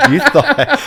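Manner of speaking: bursting out laughing; big loud laugh through words; loud laughter. Real, not acted. Laughing hard at the story.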